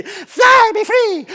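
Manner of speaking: very high, loud sing-song release; a hammed-up cry; soaring mock cry. Instructor jokingly urging Jan off the edge, very high and loud. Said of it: "I'm thinking".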